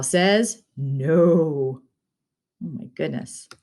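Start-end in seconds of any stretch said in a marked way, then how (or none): none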